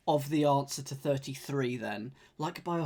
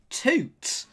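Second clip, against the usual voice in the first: high, loud goofy toot; silly clown toot; 11 semitones higher, 6 dB louder than usual. Pretend. Imitating horn.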